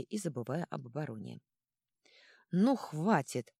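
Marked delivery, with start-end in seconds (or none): none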